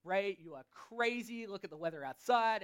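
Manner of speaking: ordinary talk, nothing marked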